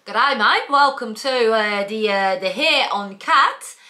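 put-on Australian accent. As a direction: play an Aussie shop host, hamming the Australian accent. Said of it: "tell me an accent, and I'll do this whole thing in it".

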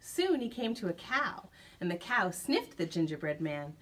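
usual speaking voice

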